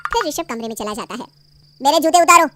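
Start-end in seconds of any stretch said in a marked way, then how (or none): 1.8-2.5 loud, curt command; bossy order. Acted. Husband curtly ordering his wife to take off his shoes. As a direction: order her around like a servant.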